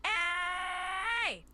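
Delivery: "Ay" held long and high; long, high, emphatic "Ay"; maximum emphasis. Shown as a demonstration. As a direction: stretch "Ay" with force on a high note. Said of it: "maximum emphasis and length".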